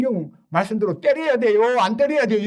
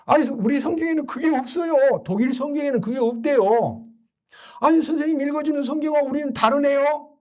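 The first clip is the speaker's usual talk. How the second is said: lightly voiced higher doctor, protesting; puzzled doctor pushing back; a hint of a baffled doctor; doctor objecting; 8 semitones higher than usual. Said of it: "그 사람이 한 분이 의사인데요".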